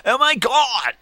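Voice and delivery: sudden shrieked Oh my God, very high; loud shriek. Shown as a demonstration. Showing how he startles a room, piercing shriek. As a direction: blurt it out as a shrill shriek in a crowded room. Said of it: "in the middle of a room filled with people is go".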